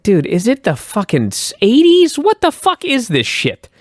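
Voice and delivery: mock outrage at a dated gimmick; incredulous outburst. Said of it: "I'm like".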